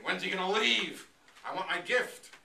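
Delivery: loud, greedy demand; impatient loud complaint. Acted.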